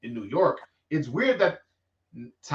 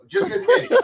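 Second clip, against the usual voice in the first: high, laughing tease; teasing laugh; 4 dB louder than usual. Real, not acted. Teasing his friend about staying in New York, laughing. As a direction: laugh it out while needling him.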